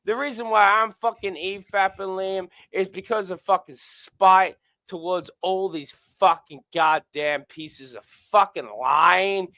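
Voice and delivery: usual speaking voice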